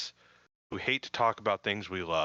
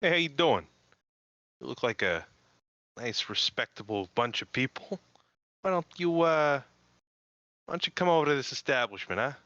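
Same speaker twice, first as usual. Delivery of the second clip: hammed-up New York Boston accent, higher pitched; butchered New York Boston accent; 3 semitones higher than usual. Podcast host greeting listeners in a fake New York Boston accent. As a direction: play a pushy New York Boston barker. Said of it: "butchered New York Bostonian accent".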